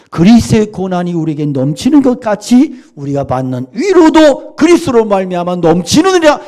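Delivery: a preacher booming scripture to the congregation; fervent proclamation. Real, not acted. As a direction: proclaim the verse at full force. Re-proclaiming the verse.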